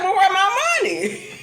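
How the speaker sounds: high, laughing indignant question; half laughing, half fed up; laughing outrage. Retold or imitated. Customer to cashier about her missing money, high laughing outrage.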